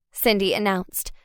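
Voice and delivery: plain reading voice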